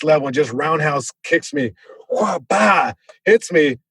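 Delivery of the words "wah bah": higher, punchy impact noises; whooshing kick, then the smack; imitated kick impact. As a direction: sound out a roundhouse landing.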